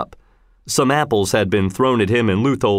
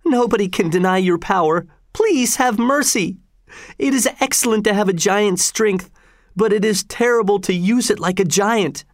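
higher-pitched, earnest pleading; crying out for mercy; 10 semitones higher than usual. A narrator's reading. Grandfather begging the governor for mercy, high-pitched pleading. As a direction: implore him, lifting the pitch throughout. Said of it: "he cried".

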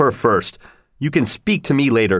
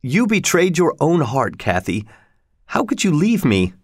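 mildly tearful, higher-pitched reproach; crying reproach; 5 semitones higher than usual. A narrator's reading. Crying lover reproaches his dying beloved, mildly tearful. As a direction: accuse with a touch of weeping. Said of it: "Breathing heavily and crying, he said".

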